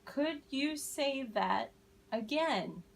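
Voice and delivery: slow, clear lead for the class; slow choral model. Shown as a demonstration. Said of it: "All together now".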